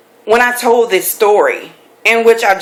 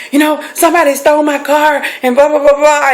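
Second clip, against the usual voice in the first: retold frantic report; 6 semitones higher, faster than usual. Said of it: "And I said".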